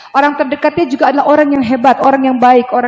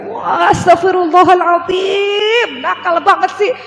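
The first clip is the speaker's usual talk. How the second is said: high, slow drawn-out astagfirullahaladzim; a mother aghast, stretching the word; shocked exclamation; 8 semitones higher, slower than usual. Retold or imitated. Mother aghast at the child, slow high exclamation.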